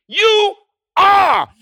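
ringing stress on "are"; emphatic YOU ARE. Acted. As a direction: proclaim "You" and "are" as separate blows. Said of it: "that R would be in all caps".

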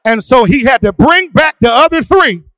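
loud, high, sung-out sermon cadence; preaching at full force. Acted. A pastor calling his church to face demons, fervent and loud. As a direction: drive it home with whooping fervour.

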